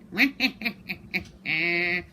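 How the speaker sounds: odd squawking bird laugh. Acted. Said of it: "like a bird laughing".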